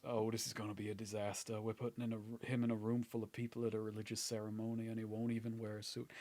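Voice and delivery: hushed, low grumble to oneself; dismayed aside. Acted. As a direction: mumble it low in resigned dismay. Character despairs aside after facepalming.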